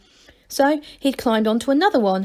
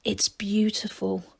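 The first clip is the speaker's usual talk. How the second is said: softer, hushed; lowered voice; 8 dB quieter, slower than usual. Acted. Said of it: "he lowered his voice".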